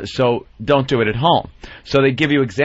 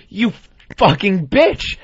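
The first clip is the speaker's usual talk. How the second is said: higher, drawn-out retold yell; mock angry outburst; 7 semitones higher, 4 dB louder, much slower than usual. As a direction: imitate his sudden rage, stretching the words. Retelling the PSA man's outburst.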